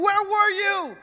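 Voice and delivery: put-on high, upset question from a frightened child; panicked son. A lost son asking his dad, high and upset. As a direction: demand it like a scared, relieved kid.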